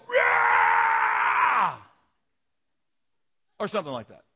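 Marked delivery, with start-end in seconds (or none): 0.1-1.8 long, loud imitated cheer of angels; roaring crowd cheer. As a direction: let out a drawn-out celebratory roar. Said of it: "all the angels go".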